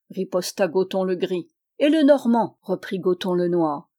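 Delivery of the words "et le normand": plus haut et plus fort, piquante; vive question. Acted. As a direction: relance la commère avec curiosité. Commères qui se répondent. (une commère relance, vive et haute)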